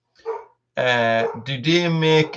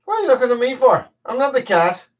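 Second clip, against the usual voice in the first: teasing the dog; 10 semitones higher, faster than usual. Real, not acted. Talking to the dog playfully.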